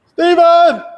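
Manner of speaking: loud, high call across the room; calling out to a friend nearby. Real, not acted. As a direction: shout his name to get his attention.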